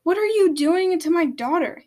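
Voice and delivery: high, loud demand; angry shout. A narrator's reading. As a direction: shout it as an alarmed challenge. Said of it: "he shouted as he looked".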